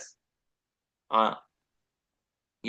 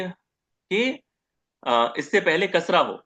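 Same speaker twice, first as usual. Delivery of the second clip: ordinary delivery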